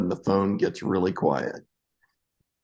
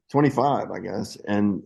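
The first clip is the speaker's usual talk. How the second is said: ordinary talk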